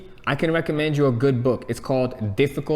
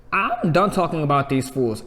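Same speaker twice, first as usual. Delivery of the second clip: ordinary talk, nothing marked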